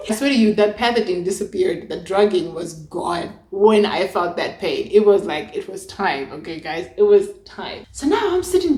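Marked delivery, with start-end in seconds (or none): none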